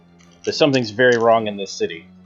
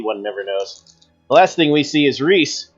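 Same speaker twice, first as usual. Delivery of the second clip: ordinary talk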